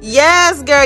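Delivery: ordinary talk, nothing marked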